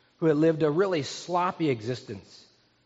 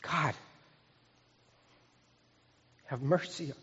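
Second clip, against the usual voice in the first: soft, broken up by long pauses; humble penitent plea; 9 dB quieter than usual. Acted. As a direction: beg God quietly, word by word. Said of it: "That's a different tone".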